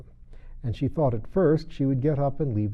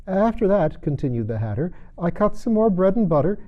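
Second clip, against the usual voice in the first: Hatter voice; 8 semitones higher, 5 dB louder than usual. Acted.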